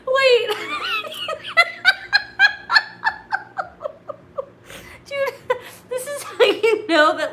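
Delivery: high, loud, helpless laughter; words breaking up into laughter; real laughing fit. Real, not acted. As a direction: try to talk while cracking up. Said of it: "Now I'm laughing".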